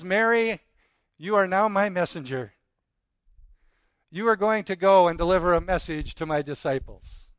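name her, then send her out; commissioning call. Acted. Jesus sends Mary to his disciples with a loud, exalted charge. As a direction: lift it high and strong like a charge.

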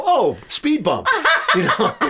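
laughing. Retold or imitated. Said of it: "and I go".

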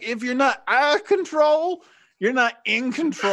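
high, cheeky gnome voice with a laugh; a daredevil motto, chirped high; cocky gnome. Retold or imitated. Gnome daredevil boasting to the crowd, high and cheeky. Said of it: "one gnome's like".

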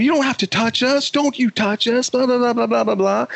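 high-pitched imitation of indignant yelling; mock yelling. Line cutters yelling at the man who touched them, mock yelling. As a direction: imitate their protest, running into babble. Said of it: "and they're yelling".

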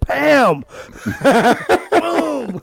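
triumphant. Real, not acted. Podcaster landing a shot at last, laughing triumph.

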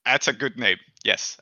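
ordinary delivery

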